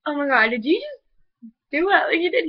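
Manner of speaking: thrilled gasp at what he just did; excited disbelief. Retold or imitated. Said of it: "I was like".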